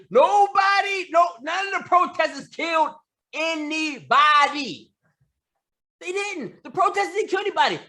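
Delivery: rising to a shout, hammering nobody; angry shout. Real, not acted. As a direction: yell it out in outrage. Angry rant about protesters.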